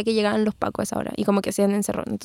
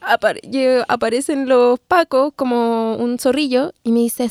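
normal talking voice

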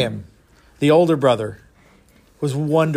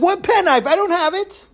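much higher, wide-eyed fake innocence; innocent denial; 13 semitones higher than usual. Moshe lying to his mother about the penknife, high fake-innocent squeak. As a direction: play dumb in a squeaky kid voice.

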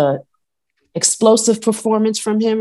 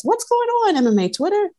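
exasperated rant; 12 semitones higher than usual. Real, not acted. Ranting at Twitter.

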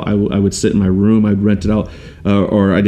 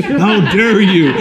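mock outrage; 10 semitones higher, much slower than usual.

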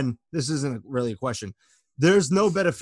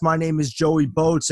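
usual speaking voice

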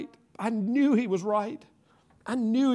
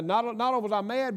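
ordinary delivery

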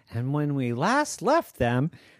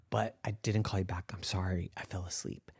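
sorry; 8 semitones lower, 11 dB quieter, much faster than usual. Acted. Alex apologizes, fading.

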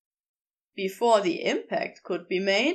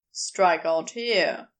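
lower, firmer man's voice; a lower Crowley, teasing and sure; Crowley's voice; 6 semitones lower, slower than usual. Acted. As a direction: drop low and speak with easy authority.

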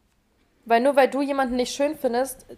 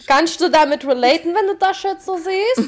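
gespielt freundliche Frage, höher; übertrieben höflich; 6 semitones higher, 7 dB louder than usual. Retold or imitated. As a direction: scheinheilig nett zur Mutter. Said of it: "der Mutter zeigen und sagen". (scheinheilig höflich zur Mutter)

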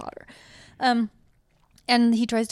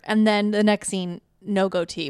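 ordinary talk, nothing marked

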